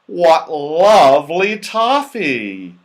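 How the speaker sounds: slow, loud, greedy delight. A narrator's reading. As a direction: drool over the toffee. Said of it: "with great delight".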